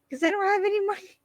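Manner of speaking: high fast sheepish whine; squeaky mock voice. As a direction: squeak it out quickly. Quoting Alex, high fast voice.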